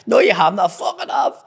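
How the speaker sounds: high, loud mock squabble; acted shouted argument. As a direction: shout the back-and-forth in comic outrage. Acted argument over the hurt back.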